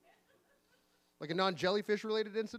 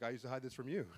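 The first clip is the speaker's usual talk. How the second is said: low, soft dad's reply; imitating dad; 5 semitones lower, 7 dB quieter than usual. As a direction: echo it back lower and quieter, as dad. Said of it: "He's like".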